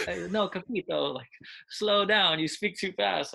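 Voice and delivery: high, loud frustrated plea to slow down; exasperated plea. Retold or imitated. Frustrated player coach to teammates.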